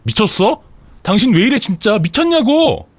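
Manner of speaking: high-pitched, acted angry yell; incensed wife, pitch soaring; outraged yelling. A narrator's reading. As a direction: cry out in disbelief at the husband. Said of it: "하면서 소리를 질렀고".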